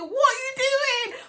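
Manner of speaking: high-pitched shouted challenge; shouting. Retold or imitated. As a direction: shout the question out, voice way up. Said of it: "This is how I'm shouting".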